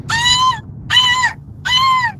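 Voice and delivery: piercing high hawk screeches, loud. Retold or imitated. Retelling a vision of a flaming hawk, shrill hawk cry. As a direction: screech like a giant burning hawk. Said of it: "it made the noise".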